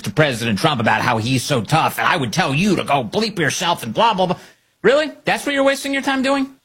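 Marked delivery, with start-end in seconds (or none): 4.8-6.6 high mocking disbelief, higher; incredulous. Real, not acted. Host scoffing in disbelief at a senator's texts, high incredulous.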